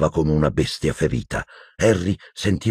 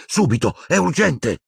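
urgent plea; 12 semitones higher than usual. A narrator's reading. Harry urgent, out of breath.

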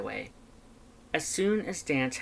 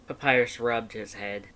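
ordinary delivery, nothing marked

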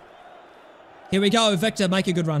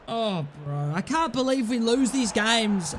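usual speaking voice

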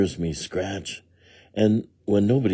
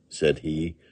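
ordinary delivery

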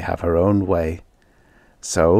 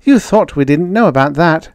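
put-on high, louder triumphant girl; proud; 8 semitones higher, 5 dB louder than usual. A narrator's reading. Jane reveals their secret knowledge, proud. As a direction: show off what you know. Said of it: "she said proudly".

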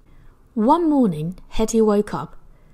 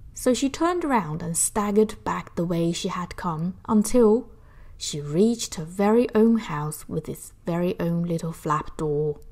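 plain reading voice

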